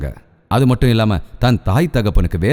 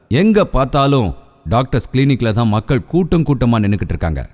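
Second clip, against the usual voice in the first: ordinary talk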